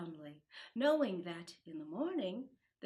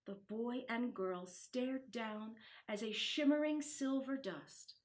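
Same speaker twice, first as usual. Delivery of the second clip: ordinary delivery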